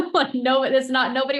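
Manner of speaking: laughing. Real, not acted. Laughing at dark room advice.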